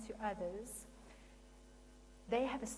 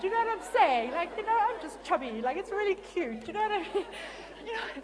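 laughing loudly; 9 semitones higher, 11 dB louder than usual. Real, not acted. Laughing at the chubby remark.

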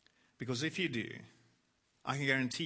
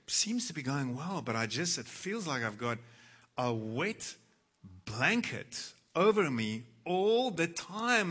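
normal talking voice

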